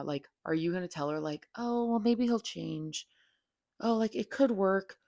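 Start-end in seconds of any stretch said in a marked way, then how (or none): 1.6-5.0 higher, slow, wishy-washy hopeful voice; mock naive reassurance. Bad friend reassuring a cheated-on friend, mock hopeful and slow. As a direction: parody the spineless friend.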